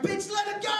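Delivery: shouted angry command; yelling at her. Retold or imitated. Comedian yells at a woman clinging to her bag. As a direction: yell it at the old lady. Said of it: "I was like".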